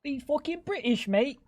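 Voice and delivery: half-sung mock British; mock British accent. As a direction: tease in a fake Brit voice.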